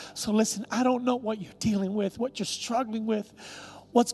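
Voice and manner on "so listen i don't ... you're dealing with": ordinary talk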